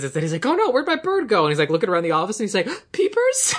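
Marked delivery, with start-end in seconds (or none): 0.4-1.4 high fretting voice, lost bird; a light mock of a worried man; worried principal. Principal's worried quote retold. 2.8-3.5 very high rising call of Peepers; mock high call for a lost bird; funny Peepers delivery. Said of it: "the way he delivers peepers".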